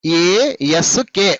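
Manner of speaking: ASK slow, each letter apart; separate letters. Shown as a demonstration. Teacher pointing out three letters to pupils, ASK slowly spelt out. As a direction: spell out ASK with gaps between letters. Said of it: "మూడు అక్షరాలు ఉన్నాయి ఇక్కడ".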